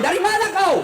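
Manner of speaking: jealous wife grilling her husband, pushing harder; sharper mock interrogation. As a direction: press the question harder the second time. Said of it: "kau interogasi".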